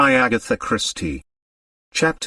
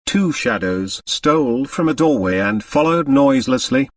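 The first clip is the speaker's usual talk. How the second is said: ordinary delivery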